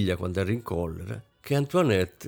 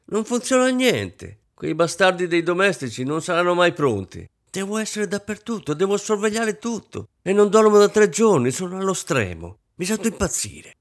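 high-pitched, frantic complaint; tearful outburst; 9 semitones higher, 4 dB louder than usual. A narrator's reading. Exhausted wife venting to her husband, high tearful outburst. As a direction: wail at the end of your tether. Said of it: "gridò Rosine con voce lacrimosa".

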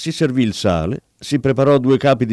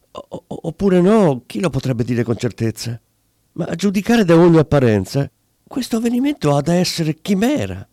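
higher, stammering, confused musing; muddled barber; 6 semitones higher than usual. Acted. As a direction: puzzle it out aloud, hesitant. Barber muddled reasoning with himself. (barbiere confuso che ragiona tra sé, balbettando)

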